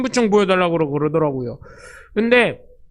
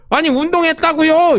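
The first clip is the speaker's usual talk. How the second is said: insistent excuse; 7 semitones higher, 3 dB louder than usual. Retold or imitated. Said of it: "이러고".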